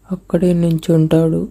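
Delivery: plain reading voice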